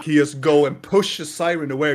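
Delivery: ordinary talk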